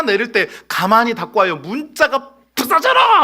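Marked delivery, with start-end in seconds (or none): 2.5-3.2 angry shout. Acted. Slamming the car door in anger.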